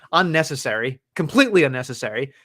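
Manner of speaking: ordinary delivery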